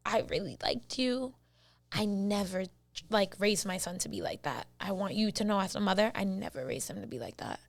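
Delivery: soft, low retelling of a mother's apology; gentle sincerity. Retold or imitated. Boyfriend's mother to wronged girlfriend, gently sincere. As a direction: speak softly, as if holding her hand. Voicing his mother holding her hand.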